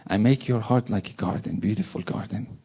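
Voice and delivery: normal talking voice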